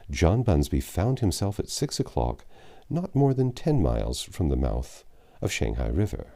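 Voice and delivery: usual speaking voice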